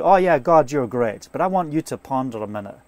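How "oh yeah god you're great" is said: casual, perfunctory agreement; loud start, dropping off dismissively; offhand intellectual assent. Shown as a demonstration. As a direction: praise God without really feeling it. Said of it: "an intellectual point of view".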